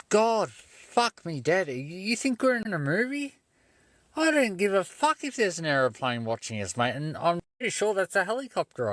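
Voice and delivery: high, stretched incredulous scoff; mock exasperation. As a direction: scoff like he's in a bad movie. Mocking the cop's aeroplane claim.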